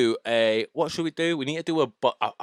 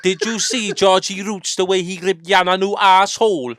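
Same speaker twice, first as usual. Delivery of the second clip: loud, high, gossipy Welsh; hammed-up Welsh, pitched up; Welsh accent; 7 semitones higher, 7 dB louder than usual. Shown as a demonstration. Gossiping about battlers in the accent, Welsh. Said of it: "wanna do a Welsh accent".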